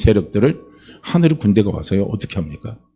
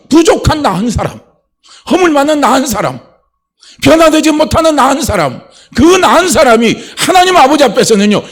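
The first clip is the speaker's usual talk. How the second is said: rising, louder preaching climb; full-force preaching; 13 semitones higher, 7 dB louder than usual. Acted. Preacher urging the congregation, climbing and louder. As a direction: build the sermon toward its peak. Peak of sermon.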